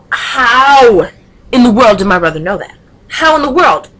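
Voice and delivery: loud, high disbelief; loud "how in the world" in amazement; amazed. Real, not acted. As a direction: marvel out loud at how he knew. Amazed at brother's knowledge.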